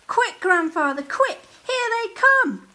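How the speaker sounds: high, loud excited shouting; urgent excited call. Acted. Jack urging Grandfather to grab the boats, high loud excitement. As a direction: yell it out as the boats appear.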